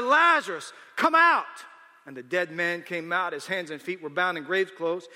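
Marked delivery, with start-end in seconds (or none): 0.0-1.5 loud, high, ringing shout; commanding shout. A narrator's reading. Jesus calling Lazarus out of the tomb, shouting. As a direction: shout to summon the dead man out. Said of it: "Then Jesus shouted".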